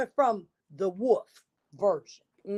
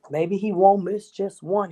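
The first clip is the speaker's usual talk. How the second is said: ordinary delivery, nothing marked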